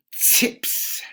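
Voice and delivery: chips, the ch sound drawn out; chips with a hissed ch. Shown as a demonstration. Teacher shows the ch sound to the class, hissed. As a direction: push air through the ch in chips. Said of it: "combination of S and H".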